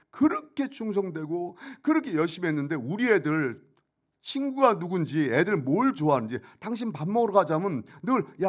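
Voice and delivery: voicing the hurt wife pouring out her complaints; wife's accusation. Wife's tearful accusation, retold.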